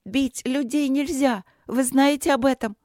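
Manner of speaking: put-on high, ringing, indignant child's cry; grido squillante. A narrator's reading. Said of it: "крикнула она Чету так звонко".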